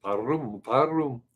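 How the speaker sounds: warum with a rolled r; German warum. Shown as a demonstration. Repeating the stuck German practice word warum. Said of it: "now I got Warum stuck in my head".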